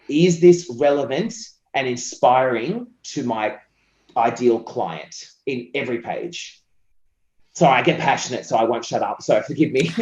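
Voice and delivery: ordinary talk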